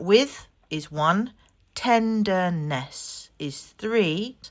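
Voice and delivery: slow, drawn-out counting; slow teacher counting "tenderness"; counting syllables slowly. Shown as a demonstration. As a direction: count each word's beats slowly for the class. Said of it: "let's count it out".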